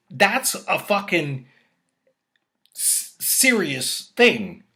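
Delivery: slow, high, loud emphatic drag; slow emphasis. Real, not acted. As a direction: drag out serious thing. Slowed emphatic point.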